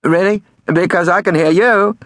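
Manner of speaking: high, loud prank-call voice; prank caller. A narrator's reading. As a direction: deliver the punchline high and loud. Prank caller delivers punchline.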